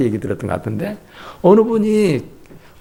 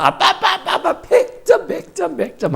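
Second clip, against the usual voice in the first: put-on very high, laughing child calling daddy; excited child. Child shows daddy a perfect score, high and laughing. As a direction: squeal with joy like a proud kid.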